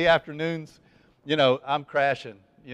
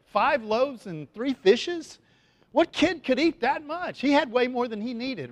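amazed; 7 semitones higher than usual. Real, not acted. Amazed at the boy's lunch.

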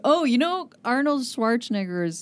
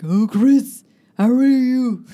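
Arnold greeting; 4 semitones higher, 9 dB louder, slower than usual. Retold or imitated. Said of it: "Arnold Schwarzenegger was like".

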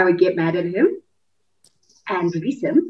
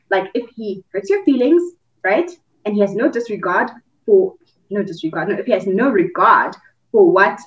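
ordinary talk

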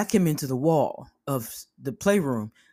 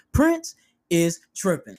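normal talking voice

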